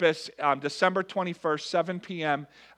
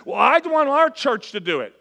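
higher, louder mock complaint; mock whiny churchgoer; 9 semitones higher, 9 dB louder than usual. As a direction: parody the grumbling member, pitched up. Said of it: "People say".

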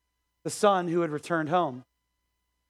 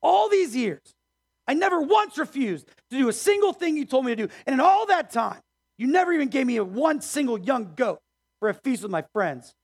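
indignant son; 4 semitones higher than usual. Acted. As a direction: let the grievance burst out. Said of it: "He's screaming at his dad".

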